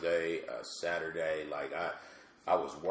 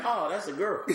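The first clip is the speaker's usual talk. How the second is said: high voice; 12 semitones higher, 4 dB louder than usual. Shown as a demonstration. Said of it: "just that highness".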